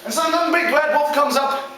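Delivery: plain reading voice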